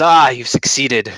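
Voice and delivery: innkeeper greeting. Acted. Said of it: "and he says".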